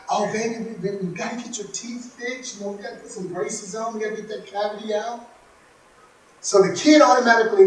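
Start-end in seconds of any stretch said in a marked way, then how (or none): 0.1-5.2 much softer, coaxing mom voice; soothing mother. Retold or imitated. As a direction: gently talk the child round. Mother soothing her child.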